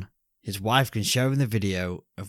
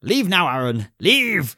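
yelling leave; 7 semitones higher, 7 dB louder than usual. Retold or imitated. Voicing a character's warning.

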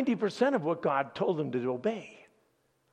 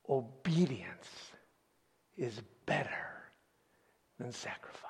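hushed solemn whisper; 4 semitones lower, 8 dB quieter than usual. Acted. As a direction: let the verse sink in, barely voiced. Solemn key line of sermon.

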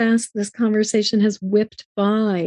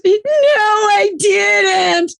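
put-on much higher, louder, very slow wailing little girl; mock sobbing toddler; 11 semitones higher, 4 dB louder, much slower than usual. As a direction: stretch it out like a hysterical crying toddler. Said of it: "She's just sobbing hysterically".

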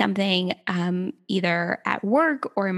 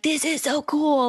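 higher, slower, drawn-out marvel; retold excitement; 5 semitones higher than usual. Podcast guest recalling finding human design, excited. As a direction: gush it slowly, pitched up.

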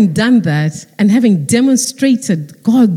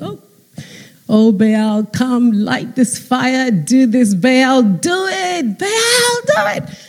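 pleading call that climbs higher and higher; mock pleading to Baal; 5 semitones higher, slower than usual. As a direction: beg the idol, pushing pitch up to a thin high cry. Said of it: "these prophets of Baal started calling".

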